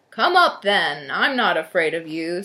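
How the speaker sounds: big loud goat challenge; bold defiance. A narrator's reading. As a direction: face down the troll at full voice. Big goat defies the troll.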